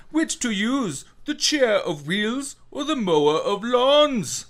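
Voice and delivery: high, slow, theatrical character voice; Skarsgård impression. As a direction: camp it up like a ham villain. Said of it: "Skarsgård making his appearance".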